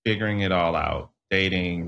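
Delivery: ordinary talk, nothing marked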